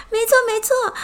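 high, loud delighted cry; happy ant cheering. Acted. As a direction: burst out with joy. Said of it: "蚂蚁开心地说".

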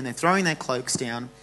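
usual speaking voice

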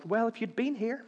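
soft, polite, restrained complaint; polite mild reproach. Shown as a demonstration. Preacher mocking a polite complaint to Jesus, soft and restrained. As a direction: say it too politely, holding back. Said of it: "go up politely and go".

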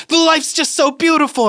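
very high, hammed-up teary gush; mock sobbing party girl. Imitating a sobbing girl at a party, squealing high. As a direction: squeal it like a crying drunk girl. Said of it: "crying and sobbing".

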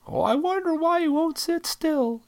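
high mocking sarcastic voice. Sarcastic jab at parents giving sugar.

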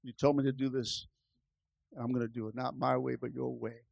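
very low, very soft surrender; quiet low prayer of obedience; hushed resolve. Acted. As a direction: murmur it low, giving in to God. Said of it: "He says".

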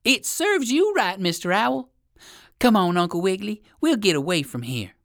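put-on high, loud squirrel boy; calling out. A narrator's reading. Squirrel boy mocks owl, then calls rabbit, high calling. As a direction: crow at the loser, then hurry a friend along. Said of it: "called the squirrel boy".

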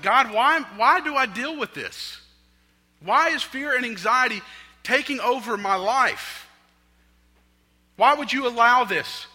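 re-enacted angry cry, high and loud; mad. Man re-enacting his angry questions to God, mad outcry. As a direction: demand answers, loudly and at high pitch. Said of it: "And I was mad".

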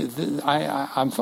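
ordinary talk

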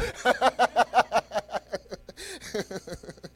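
warm chuckle after singing; laugh. Real, not acted. Preacher laughs at his own carol singing, warm chuckle. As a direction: let a happy laugh roll out.

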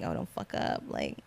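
whispered self-warning, barely voiced; nervous whisper. Telling herself not to fail her first paid job, whispered. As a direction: hush it to yourself like a nervous pep talk.

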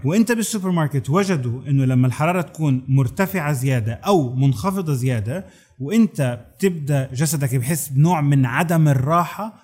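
ordinary talk

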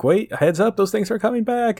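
high-pitched urgent heads-up; mock warning. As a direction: call out a quick warning, playfully urgent. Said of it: "Juno kind of, you know, like".

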